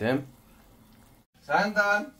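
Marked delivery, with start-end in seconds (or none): 1.4-2.1 calling out. Real, not acted. Calling out to child in house.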